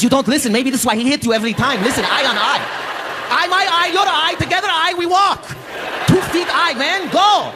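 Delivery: dad coaching his son to fight, high and pushy; dad's voice. Retold or imitated. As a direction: squeal the dad's nonsense pep talk. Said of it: "He goes".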